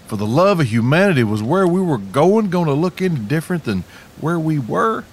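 storyteller's comic exasperation; mock exasperation. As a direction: protest as if at wit's end. Narrator protests the endless boat ride.

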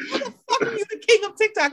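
high, fast, laughing incredulity; laughing disbelief. Retold or imitated. Host retelling her reaction to the TikTok king, high fast laughing disbelief. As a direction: scoff through laughter at the claim. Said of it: "I was like".

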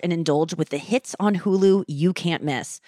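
upbeat host-read streaming ad; podcast ad spot, read casually. Acted. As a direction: sell the show to listeners. Host reads a Hulu ad.

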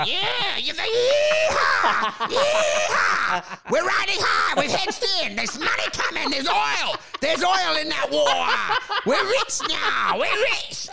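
high-pitched whooping cowboy broker; yeehawing salesman. Acted. Snakeskin-shoed broker ringing a client, whooping wildly. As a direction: holler it like an oil strike. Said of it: "you just want a guy in, like, um, snakeskin shoes ringing up going".

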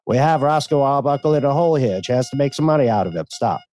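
put-on lightly done transatlantic accent; old Hollywood transatlantic accent, quick. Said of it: "We're gonna go with a transatlantic voice".

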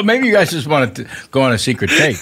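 ordinary talk

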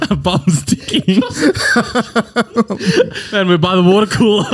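breaking up with laughter; words squeezed out between laughs; laughing. Real, not acted. Laughing at the absurd scene.

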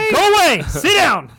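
loud, high-pitched mock dog shout. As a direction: yell commands as a talking dog. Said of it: "Jason's gonna hear his dog go".

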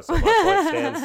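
high, slow, laughing through it. Real, not acted. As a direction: crack up while saying it. Laughing triumph after the question.

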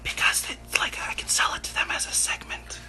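breathy, hushed aside whisper; stage whispering. Pretend. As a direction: whisper it like a secret for the audience. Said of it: "They can hear you stage whispering".

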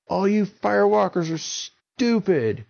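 nasty shout. Acted. Avon jeering at the firewalkers, loud jeer. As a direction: sneer at them all.

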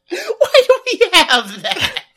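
a slow question pushed out through laughter; bemused, slow question broken by laughter; laughing while asking. Real, not acted. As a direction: ask it while still cracking up. Laughing at the show.

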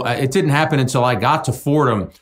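ordinary talk

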